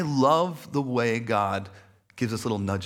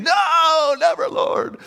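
high mock shout, first word stretched out; hammed-up cry of horror; overdramatic protest; 9 dB louder, much slower than usual. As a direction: melodramatic refusal at full volume. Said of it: "over dramatic, you know, response".

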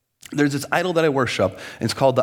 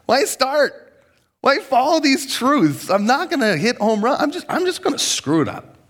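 defeatist whine; 10 semitones higher than usual. Pretend. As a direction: moan like you've already failed. Voicing the perfectionist lie.

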